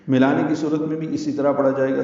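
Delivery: ordinary talk, nothing marked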